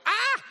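high, loud mock scream of pain; mock tree scream. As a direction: yelp like a pruned tree in pain. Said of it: "If it could scream, it'd go".

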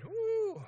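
high, loud "whoo"; whooo, long and high-pitched; high whoop. Shown as a demonstration. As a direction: let out a big rising whoop. Mock dread at married money stress.

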